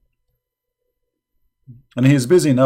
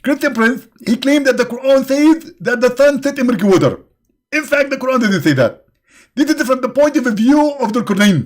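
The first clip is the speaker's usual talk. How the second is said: high mocking whine of an opponent's excuse; mocking Zakir Naik; 9 semitones higher, 4 dB louder than usual. Mocking a rival preacher to his audience, high and sneering. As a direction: mimic the preacher, pitched high and smug. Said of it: "Zakir Naik will say to you".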